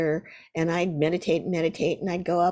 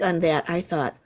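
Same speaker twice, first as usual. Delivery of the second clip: ordinary talk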